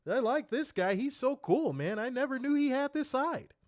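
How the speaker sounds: lightly voiced eager friend; mock Werner voice. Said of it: "Werner's like".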